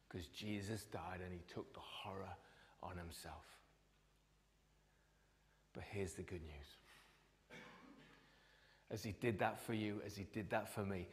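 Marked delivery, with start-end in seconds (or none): 0.1-7.6 soft whisper, slowing at the end; hushed whisper. Real, not acted. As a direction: whisper it like a secret revealed. Preacher whispers key lines.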